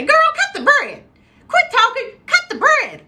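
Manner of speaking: high, loud mama scolding; bossy mom. Retold or imitated. Woman imitating her mom telling her to cut bread, bossy and high. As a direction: order them about in mom's high, loud voice. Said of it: "That's what she would say".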